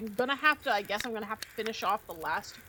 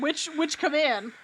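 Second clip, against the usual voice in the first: mock innocence; 5 semitones higher, 10 dB louder than usual. Feigning innocence to Miss Vera.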